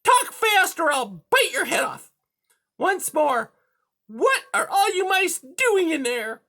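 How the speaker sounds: high, loud interrogating cat; high-pitched bullying villain; menacing cat. A narrator's reading. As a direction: demand an answer in a piercing high voice. Cat threatens the mouse.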